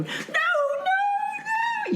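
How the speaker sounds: very high, drawn-out wailed refusal; tearful wail, reenacted. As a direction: wail it in mock crying panic. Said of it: "And I'm crying".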